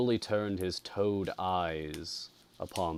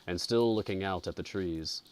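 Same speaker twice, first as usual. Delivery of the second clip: plain reading voice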